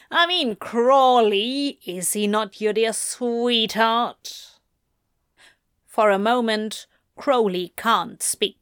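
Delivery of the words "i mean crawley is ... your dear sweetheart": slow, drawn-out mock sweetness; taunting demon duke. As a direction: stretch the name and needle him, pitched up at first. Demon duke taunting Crowley about lover.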